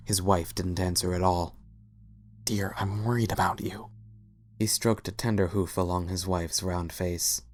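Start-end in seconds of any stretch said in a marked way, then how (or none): 2.5-3.9 whispered worry. A narrator's reading. Husband voiced tenderly.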